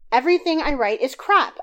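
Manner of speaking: upset wife near tears, pouring out her bad day; tearful outburst. A narrator's reading. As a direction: unload everything at once, close to tears. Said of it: "I was in tears".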